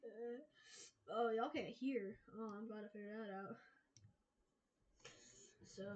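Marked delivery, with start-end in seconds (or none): none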